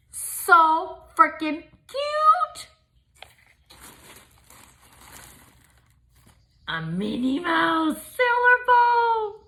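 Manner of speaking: high, slow gushing coo; slow high adoring squeal. Real, not acted. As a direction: coo over the bows. Showing cute bows.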